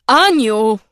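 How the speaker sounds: loud shocked call; dismayed cry. A narrator's reading. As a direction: gasp the name in dismay. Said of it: "wykrzyknęła skonsternowana".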